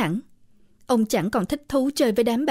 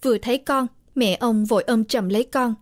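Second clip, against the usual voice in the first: plain reading voice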